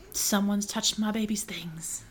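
creepy whispered line. Acted. Mocking an obsessive vampire boyfriend, creepy whisper.

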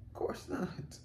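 a breathy whisper, barely voiced; whispered aside. Real, not acted. Whispering to viewers that nobody meets the standard, hushed. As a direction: drop to a whisper for the last words. Soft aside after emphatic point.

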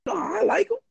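high, light imitation of a young woman; a quick high girlish quote; girl's voice. Girl at the party turning him down, high mock voice.

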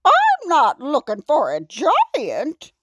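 high, emphatic frog voice; cowardly frog. A narrator's reading. Frog hides behind a tree from the giant, high-voiced. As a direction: pitch it up as a frog backing out.

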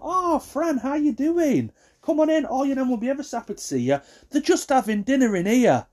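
very high, sing-out greeting; cheery care worker. Retold or imitated. Care staff greeting a visitor, high and cheery. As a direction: welcome a visitor in a high chirpy voice.

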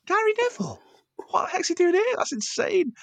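a squeal of starstruck shock; shocked disbelief. Retold or imitated. Staff hearing a football star is here, squealing shock. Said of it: "We're like".

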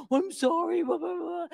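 imitating her own tearful self apologizing; mock apology. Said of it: "I start apologizing".

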